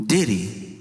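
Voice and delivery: normal talking voice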